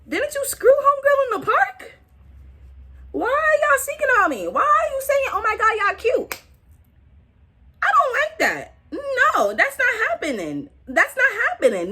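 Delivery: high-pitched jealous protest; high mock outrage. Jealous girlfriend confronts his exes, high mock outrage. Acting her jealous outburst.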